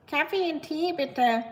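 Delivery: a hint of a goofy app voice, first word high; lightly mock the silly language-app voice; goofy Duolingo voice. Mimicking the German Duolingo voice, lightly goofy. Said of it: "the voices are so, like, goofy".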